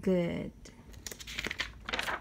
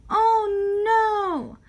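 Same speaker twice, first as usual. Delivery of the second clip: higher, crying-out oh no; mock cry of dismay; 9 semitones higher, 4 dB louder than usual. Narrator crying out as Pete hits the water, mock dismay. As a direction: wail it in pretend alarm.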